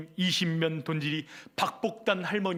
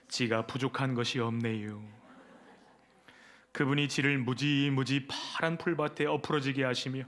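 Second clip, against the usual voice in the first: Chungcheong dialect; 2 semitones lower than usual. Acted. Pastor reads Psalm 23 parody to congregation in Chungcheong dialect. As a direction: read the psalm like a folksy Chungcheong farmer. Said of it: "충청도 버전".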